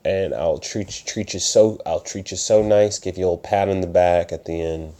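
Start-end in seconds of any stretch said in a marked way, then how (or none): none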